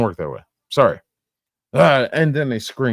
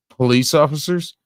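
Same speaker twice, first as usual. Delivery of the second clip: ordinary talk, nothing marked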